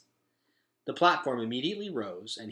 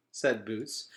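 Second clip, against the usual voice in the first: usual speaking voice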